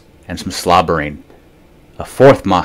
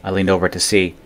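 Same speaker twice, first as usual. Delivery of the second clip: plain reading voice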